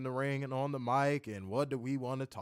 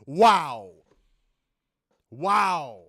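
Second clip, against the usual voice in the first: mock amazement; 9 dB louder than usual. Real, not acted.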